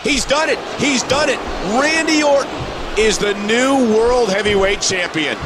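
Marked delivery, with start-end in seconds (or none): 0.0-2.5 high, triumphant announcer cry; excited title call. Acted. Commentator announcing a new champion, excited and high. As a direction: call the win in a raised, thrilled voice.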